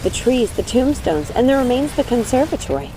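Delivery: plain reading voice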